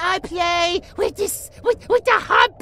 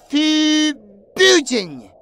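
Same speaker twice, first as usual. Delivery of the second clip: "forbidden." stretched slowly into two parts; slow, emphatic "forbidden."; FORBIDDEN drawn out; 3 semitones lower, much slower than usual. Acted. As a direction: linger heavily on "forbidden.". Other self forbids telling.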